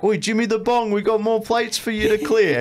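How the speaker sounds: high, loud call across the kitchen; boss calling out. Retold or imitated. Boss yelling for the worker to clear plates, high loud call. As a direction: holler at the dishhand from the back. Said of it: "he's just like".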